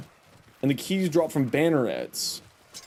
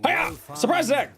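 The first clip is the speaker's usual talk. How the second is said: playful battle cry; 12 semitones higher than usual. Pretend. Playful attack call in game.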